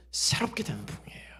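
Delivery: hushed, reverent whisper. Real, not acted. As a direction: drop to a low whisper. Whispered emphasis in sermon.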